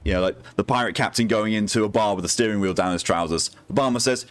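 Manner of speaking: ordinary delivery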